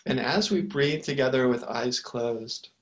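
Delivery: unhurried meditation guide with long pauses; calm, spacious guidance, letting each phrase settle; guided meditation. Real, not acted. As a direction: lead them gently into the breath, phrase by phrase.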